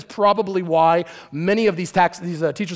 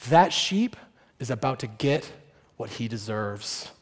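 ominous hush; 9 semitones lower, 7 dB quieter than usual. Acted. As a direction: drop it low like a threat. Pharisee's grim view of sheep.

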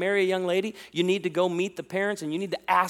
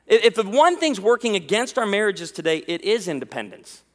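usual speaking voice

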